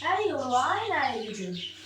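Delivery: subdued, low-key chat at home; quiet confiding to viewers; hushed talk. Real, not acted. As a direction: keep it low so the house stays calm.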